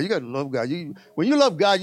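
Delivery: ordinary talk, nothing marked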